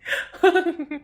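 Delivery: high mocking villain cackle; triumphant sneering laugh. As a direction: cackle in her face, cold and cruel. Villain mocks betrayed lover.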